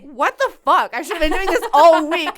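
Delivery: loud laughing what-the-fuck; amused disbelief. Retold or imitated. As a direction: laugh out the realisation. Retold surprised reaction, loud laugh.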